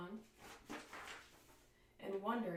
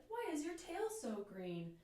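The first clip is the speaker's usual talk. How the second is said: lightly raised, bright calling voice; little bird voice; 7 semitones higher than usual. Acted. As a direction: call out like a curious little bird. Bird asks the lion.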